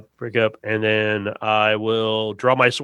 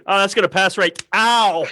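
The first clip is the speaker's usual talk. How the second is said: higher voice, ending in a high yelp; ghost caught off guard; 7 semitones higher, 3 dB louder than usual. Acted. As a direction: assume it will miss, then yelp as it hits. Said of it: "I see a ghost going".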